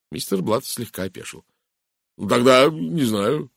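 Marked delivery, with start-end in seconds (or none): 2.2-3.5 slow, uncertain admission; mildly thrown; bewildered. A narrator's reading. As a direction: draw it out, a touch at a loss. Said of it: "растерянно произнёс он".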